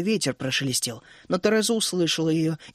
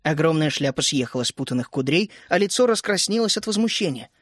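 plain reading voice

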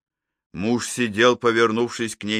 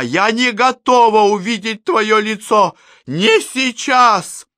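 high, loud, desperate plea; panicked cry; 12 semitones higher, 7 dB louder than usual. A narrator's reading. Panicked inner thought rising.

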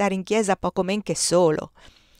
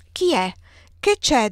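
higher, louder pressing questions; urgent questioning; 5 semitones higher, 5 dB louder than usual. A narrator's reading. Two nuns question the lay sister at the news, urgent questioning. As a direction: ask urgently, voice lifted.

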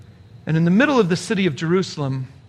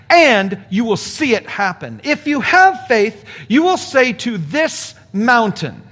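preaching emphatically; 4 semitones higher than usual. Acted. Preacher builds to climax.

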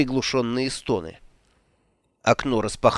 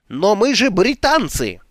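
outraged; 9 semitones higher, 5 dB louder than usual. Acted. Alex outraged at surrender idea.

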